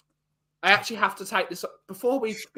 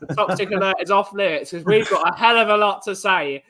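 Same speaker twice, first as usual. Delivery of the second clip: ordinary delivery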